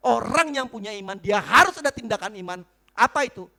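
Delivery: loud, punched preacher's delivery; emphatic preaching. Real, not acted. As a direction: drive the point home loudly. Preaches at full force. (berkhotbah dengan tegas dan lantang)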